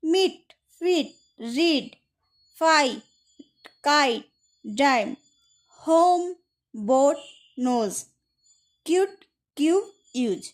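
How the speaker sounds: মিট, হোম, কিউট with vowels held like letter names; long vowel sounds. Shown as a demonstration. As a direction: give each of রিড, কাইট, নোজ a full long vowel, spaced apart. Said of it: "নামের মতো করে". (মিট, হোম, কিউট-এ স্বর অক্ষরের নামের মতো)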